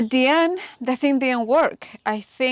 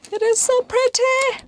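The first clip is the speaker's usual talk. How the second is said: delighted; 13 semitones higher than usual. Real, not acted. Admiring faucet.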